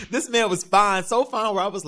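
high giggle breaking into the words; laughing. Real, not acted. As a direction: laugh it out, giddy over him. Gushing over the fine guard.

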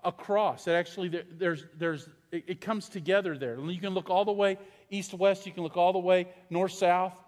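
usual speaking voice

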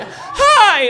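very high, loud eager hi; excited hi. Retold or imitated. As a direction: squeal hello at the hot guy. Eager greeting of hot guy.

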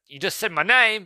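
high, loud, indignant mock outburst; exasperated complaint. Brother rebuking David in a retold film, acted exasperation.

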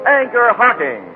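ordinary talk, nothing marked

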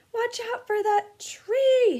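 very high, loud, slow bellow; bellowed warning. Acted. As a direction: roar the warning at the last second. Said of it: "Harry bellowed".

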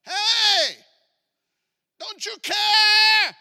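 loud, high panicked scream; acted frantic cry; freaked out. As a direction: yell like frightened disciples, dragging out the last word. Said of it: "They're freaked out".

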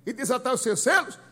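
leitura bradada, forte e aguda; brado do anjo. Retold or imitated. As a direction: proclamar a pergunta em grande voz, imitando o anjo. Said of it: "bradando com grande voz". (brado encenado de anjo forte)

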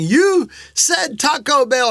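high, slow imitation of children protesting; drawn-out mock demand; kids' protest. Dad remembers his kids' cry, imitated protest. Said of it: "I was reminded of my kids Sunday saying".

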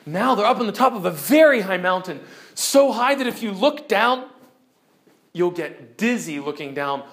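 ordinary delivery

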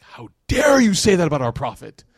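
hissed, loud outraged whisper; angry whisper. Retold or imitated. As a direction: whisper it furiously as an offended elder. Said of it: "And he's like".

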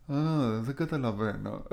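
soft, lightly mocking imitation of confusion; clueless game character. Host teasing an actor's always-puzzled game character, soft mock bewilderment. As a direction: play a baffled character, half-heartedly and quietly. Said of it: "Και είναι πάντα έτσι και κράζω".

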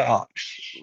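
breathy whispered oh shit; whispered curse. Retold or imitated. Caught off guard when asked to cut a promo, whispered. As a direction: mutter it under your breath. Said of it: "And I'm like".

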